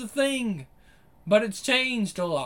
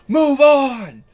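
full shout of "Move on!", much higher and louder; imitating the singer shouting "Move on!"; 5 semitones higher, 10 dB louder than usual. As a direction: belt "Move on!" like the singer does. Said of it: "He shouts".

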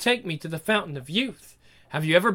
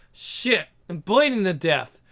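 ordinary delivery

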